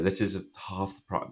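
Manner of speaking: usual speaking voice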